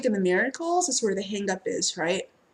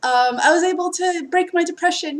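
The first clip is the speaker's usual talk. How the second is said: excited client; 8 semitones higher, 5 dB louder than usual. Retold or imitated. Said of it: "Or they come to me and be like".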